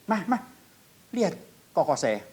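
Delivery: high-pitched calling to mother; retold child's call. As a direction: imitate an excited child getting mum's attention. Child calls mother to see vision.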